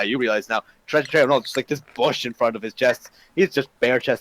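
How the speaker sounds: usual speaking voice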